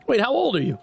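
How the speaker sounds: usual speaking voice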